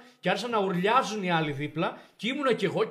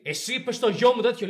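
louder, higher angry demand; angry father; 6 semitones higher, 7 dB louder than usual. Retold or imitated. Father bursting in on the principal, angry demand. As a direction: storm in and confront him.

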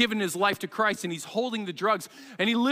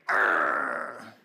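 low, drawn-out growl of effort; straining growl. Acted. Preacher acting out muscling through fear, straining growl.

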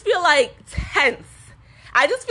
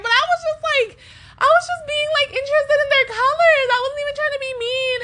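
very high, squeaky self-defense; innocent protest. Retold or imitated. Explaining to listeners she meant no harm, high-pitched innocent protest.

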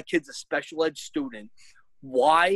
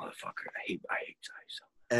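whispered, hushed hatred; seething whisper; 8 semitones lower, 12 dB quieter, faster than usual. Real, not acted. Hearing the app named again, whispering disgust. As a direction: hiss out the hate under your breath.

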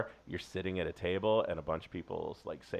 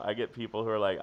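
ordinary talk, nothing marked

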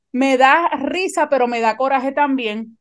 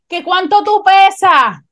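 higher, louder and slow insistent repeat; impatient nurse yelling; 6 semitones higher, 5 dB louder, slower than usual. Retold or imitated. Nurse repeats the weight question to the paramedic, loud and impatient. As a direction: demand it again across the room. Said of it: "y ella a toda boca".

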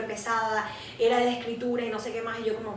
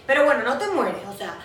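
usual speaking voice

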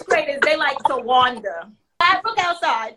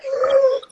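put-on shocked child gasp; 12 semitones higher than usual. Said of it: "And he said".

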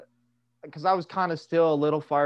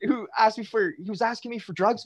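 higher and fast, leaning in urgently; hammed-up paranoid whisper-rant, higher and quick; paranoid friend rant; 6 semitones higher than usual. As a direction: rattle it off like a jittery conspiracy theorist. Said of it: "he's like".

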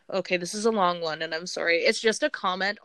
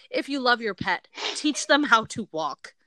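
ordinary talk, nothing marked

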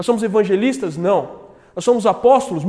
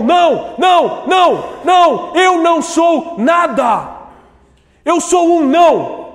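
shouted repeated não, higher and louder; emphatic refusal; 8 semitones higher, 3 dB louder, slower than usual. Acted. João responde aos sacerdotes, gritando o não. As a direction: cry out the denial.

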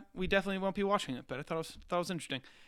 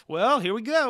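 announcer voice; 6 semitones higher, 6 dB louder than usual. Retold or imitated. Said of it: "it's him being like".

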